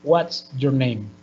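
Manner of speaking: slow "What's your name?" dropping on "name"; measured WH-question, "name" falling at the end; falling intonation. Shown as a demonstration. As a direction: let "name" glide down to a close.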